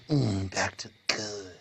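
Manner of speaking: a hammed-up nanny hushing a child; mock lullaby. TSA voiced as a lullaby.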